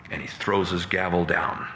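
usual speaking voice

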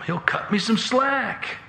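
high, drawn-out smug assurance; cocky confidence. Acted. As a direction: sing it out, sure of getting off. Cocky son expects dad's favour.

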